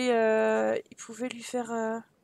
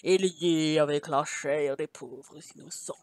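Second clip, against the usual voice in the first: sinister book voice; 7 semitones lower, 5 dB louder than usual. A narrator's reading. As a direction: boom it out, sinking into a very low evil laugh. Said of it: "une voix semblable au cri de mille corbeaux affamés".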